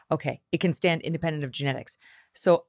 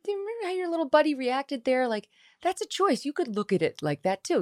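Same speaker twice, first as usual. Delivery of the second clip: higher, gentle fatherly advice, imitated; husband coaching son; 10 semitones higher than usual. Mother voicing her husband coaching their son, gentle. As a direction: coax a kid into seeing a choice. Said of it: "He says".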